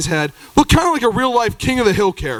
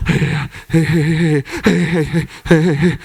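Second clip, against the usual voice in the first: low insistent heys; 5 semitones lower than usual. Retold or imitated. Jeff tapping shoulder repeatedly.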